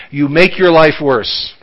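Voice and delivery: usual speaking voice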